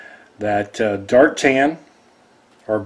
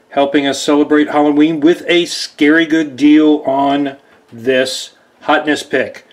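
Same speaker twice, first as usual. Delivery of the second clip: ordinary talk, nothing marked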